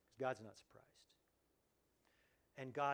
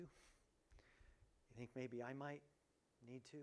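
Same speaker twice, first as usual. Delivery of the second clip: slow and much softer, as if to himself; quiet self-question; 9 dB quieter, slower than usual. Acted. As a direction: drop the voice and turn the question inward, slowly. Same question turned inward.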